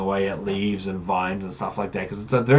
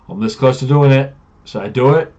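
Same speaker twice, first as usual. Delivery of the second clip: louder, higher, itching to do it; eager temptation; 5 semitones higher, 9 dB louder than usual. Retold or imitated. Player asking if he may shove the paladin, eager and loud. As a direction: barely hold back a mischievous urge.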